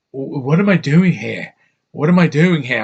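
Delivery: lightly mocked lost-actress voice; a hint of bewildered British mimicry; Keira Knightley. Man mocking an actress who looked lost on screen, lightly put-on bewildered voice. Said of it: "You looked lost. You were like".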